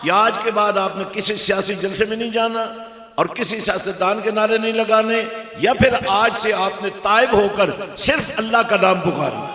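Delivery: high, very loud fiery exhortation; full-force sermon shout; preach. Real, not acted. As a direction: thunder it out at the congregation.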